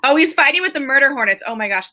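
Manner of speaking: ordinary talk, nothing marked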